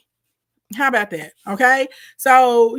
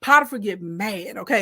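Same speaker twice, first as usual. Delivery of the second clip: ordinary talk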